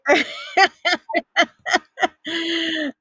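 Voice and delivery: long high laugh. Real, not acted. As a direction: laugh hard. Laughs at death joke.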